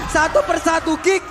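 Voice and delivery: loud hyped play-by-play; esports caster. Real, not acted. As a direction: keep the pressure in the call. Caster hype during team fight. (komentator esports bersemangat)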